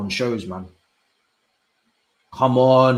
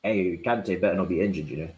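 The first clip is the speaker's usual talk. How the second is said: ordinary talk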